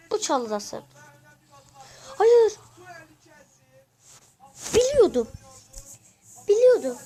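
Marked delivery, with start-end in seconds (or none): 2.2-7.0 loud, high cry of defeat; anguished shout. Real, not acted. Gamer shouting as the invisible enemy kills him. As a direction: yell as you get caught.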